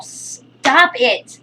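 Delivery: high, loud scolding command; shouting at dog. Real, not acted. Telling her dog off at the bowl, loud shout. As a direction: cut the dog off hard.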